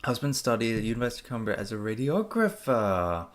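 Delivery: usual speaking voice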